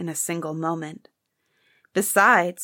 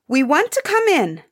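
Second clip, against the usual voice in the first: sharp and demanding, pitched up and louder; 8 semitones higher, 5 dB louder than usual. Acted. Prince to the servants blocking the door, sharp demand. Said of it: "said one of the princes sharply".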